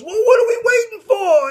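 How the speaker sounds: high, loud fired-up yell; manager's shout. Retold or imitated. Manager rallying Rocky after Adrian's words, high loud shout. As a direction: bellow it like a pumped-up trainer. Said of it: "and the manager goes".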